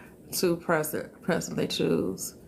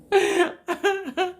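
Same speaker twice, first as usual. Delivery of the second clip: high laugh; 11 semitones higher than usual. Real, not acted. Laughing at the greetings.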